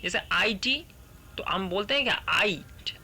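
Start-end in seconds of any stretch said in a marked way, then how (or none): none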